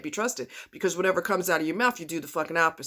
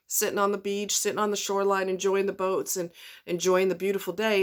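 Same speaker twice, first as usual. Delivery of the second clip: ordinary delivery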